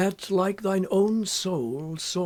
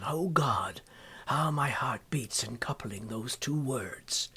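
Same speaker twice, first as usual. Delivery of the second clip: breathless whisper, lower and softer; trembling whisper to heaven; hushed whisper; 4 semitones lower, 6 dB quieter than usual. Acted. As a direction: whisper it in awe. Awed whisper in poem.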